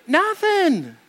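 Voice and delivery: high, defensive nothing; mock-innocent protest; indignant denial. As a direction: snap it back like you did nothing wrong. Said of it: "I'm like".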